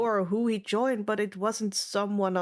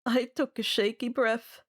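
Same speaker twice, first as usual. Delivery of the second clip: ordinary delivery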